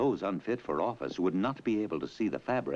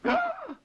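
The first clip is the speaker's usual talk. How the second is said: startled gasp; 3 dB louder than usual. Acted. Official startled.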